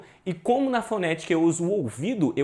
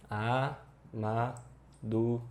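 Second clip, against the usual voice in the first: slow, each syllable of "Amadurecimento" set apart by a pause; A, ma, du, re, ci, men, to, one at a time; 4 semitones lower, 6 dB quieter, much slower than usual. Shown as a demonstration. Teacher splitting the word for students, syllable by syllable. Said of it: "separa aqui por sílaba certinha".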